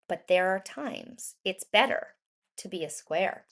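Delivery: plain reading voice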